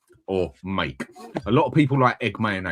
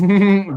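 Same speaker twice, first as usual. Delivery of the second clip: burst of laughter; 12 semitones higher, 11 dB louder than usual. Real, not acted. Laughs at scary Mia remark.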